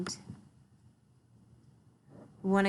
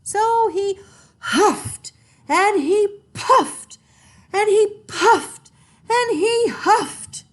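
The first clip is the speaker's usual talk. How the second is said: loud, much higher, slow and strained; straining effort; 14 semitones higher, 9 dB louder, much slower than usual. A narrator's reading. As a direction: push every word out like blowing hard. Wolf straining to blow.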